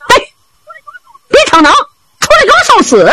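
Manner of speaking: high-pitched storyteller's yell; loud cursing challenge. A narrator's reading. He Renjie baits the bandits to come out, high scolding shout. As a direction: taunt them out with a high shout. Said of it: "他就高声大骂".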